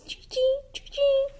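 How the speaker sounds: high chee chee chirps; sparrow chirping. Shown as a demonstration. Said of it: "And sparrow chirps".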